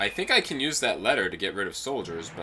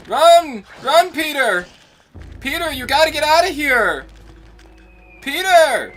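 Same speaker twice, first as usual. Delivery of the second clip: very high, loud put-on scream; hammed-up panicked yell; 9 dB louder than usual. Player warning Peter to get out, very high mock scream. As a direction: shriek a mock warning at the top of the range.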